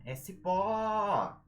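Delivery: CIPÓ with a long, high final PÓ; stretched stressed syllable. Shown as a demonstration. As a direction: hold the last syllable of "cipó" like a call. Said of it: "a sílaba que a gente estende".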